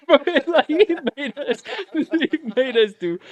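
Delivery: loud, high words through real laughter; cracking up. Real, not acted. Telling how the sergeant punished them, really laughing. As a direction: try to talk while laughing hard.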